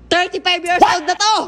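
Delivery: high, loud announcement; loud proclamation. Real, not acted. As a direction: shout it out for all viewers. Answering possible complaints about drinking. (malakas na pagpapahayag)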